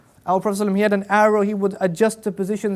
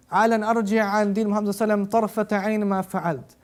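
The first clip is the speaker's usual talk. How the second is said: rapid Arabic recitation; much faster than usual. Real, not acted. Quoting the Arabic narration fast.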